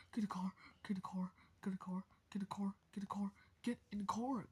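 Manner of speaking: hushed urgent whisper. Pretend. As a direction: whisper it so nobody hears. Same order repeated whispered.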